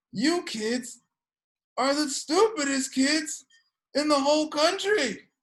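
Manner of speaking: imitated mom scolding, pitched high; mock angry yelling. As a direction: yell at the kids like a fed-up mother. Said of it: "yelling at the kids that morning".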